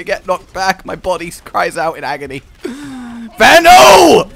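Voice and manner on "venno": loud, high, drawn-out shout; exasperated name-calling. Real, not acted.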